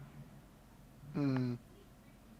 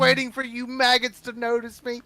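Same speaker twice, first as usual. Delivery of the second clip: scornful tirade; 6 semitones higher, 3 dB quieter than usual. Acted. Warrior ends ambush rant.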